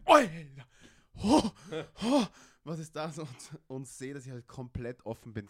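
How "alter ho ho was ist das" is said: loud, high shocked cry; shocked exclamation. Retold or imitated. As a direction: yell out in shock at the sight. Shocked in the shower, retold.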